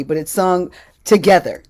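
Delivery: ordinary delivery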